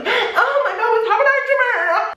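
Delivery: very high-pitched, hammed-up tearful panic; mock crying. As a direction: imitate an actress wailing through a crying scene. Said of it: "Her crying scenes were so good".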